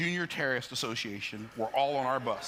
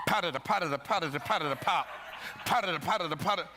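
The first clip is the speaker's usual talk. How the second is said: rapid-fire mouthed punching noises; imitated punches; 4 semitones higher than usual. Boy fighting the girl who comes at him, punchy sound effects. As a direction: pop out a flurry of blows.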